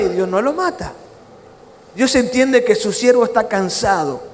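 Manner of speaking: ordinary talk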